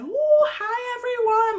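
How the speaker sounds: high, loud, gushing hello; bubbly greeting. Retold or imitated. Becca bursting in to greet the family, gushing and high. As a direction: burst in like a fireball. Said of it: "Becca came in like a fireball".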